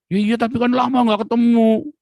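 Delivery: hammed-up tearful mother; imitating his mother. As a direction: mimic a mother who can't let go.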